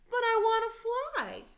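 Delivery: put-on high, loud whiny child's plea; pleading child. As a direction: beg like a little kid who won't accept no. Child frog pleading with parents.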